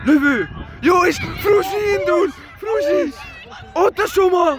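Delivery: high, loud excited yells; excited shouting. Real, not acted. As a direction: cheer the run on. Attack building up.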